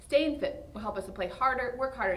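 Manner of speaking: ordinary talk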